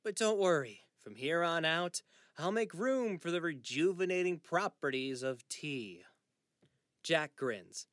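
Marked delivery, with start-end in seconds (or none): 0.0-6.1 loud, high mock reassurance; sarcastic jab at dad's tea. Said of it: "allows some sarcasm to cut through".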